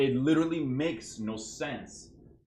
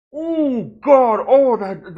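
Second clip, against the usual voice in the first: much higher, much louder pained cry; real triggered outburst; 12 semitones higher, 16 dB louder, much slower than usual. Real, not acted. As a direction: yell it out as the replay sets you off. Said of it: "that triggered me".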